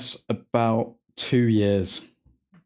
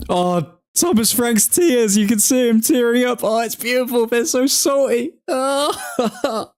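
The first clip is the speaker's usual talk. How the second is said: gleeful gloating; 8 semitones higher than usual. Real, not acted. Gloating at rival manager.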